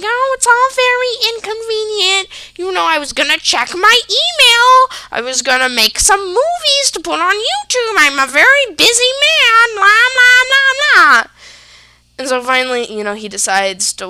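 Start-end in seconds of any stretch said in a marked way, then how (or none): 0.0-11.2 high, loud whiny mock of dad. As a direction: ham up his self-pity. Mocking dad's complaints.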